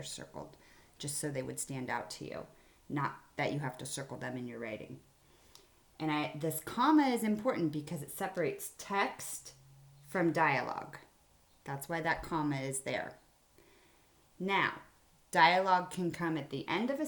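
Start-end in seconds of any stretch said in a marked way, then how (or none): none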